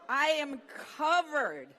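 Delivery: loud, high, slow exclamation; comic disgust. Real, not acted. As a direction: hit the punchline with mock horror. Covered in elephant diarrhea.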